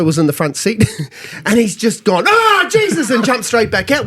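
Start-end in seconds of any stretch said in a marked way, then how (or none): 2.3-3.1 much higher, startled cry; shocked yelp. Retold or imitated. Mate jumping back out of the cab, shocked exclamation. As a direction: recoil in disgust. Mate sees cabbie, recoils.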